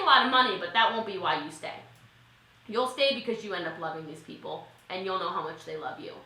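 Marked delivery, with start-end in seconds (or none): none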